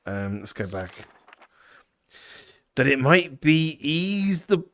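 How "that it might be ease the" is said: put-on reads the journal in a vague folksy rural accent; half-improvised rustic accent; unplaced rural accent. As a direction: give the writer a rough country accent, lightly. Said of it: "is it Welsh? What accent is this?".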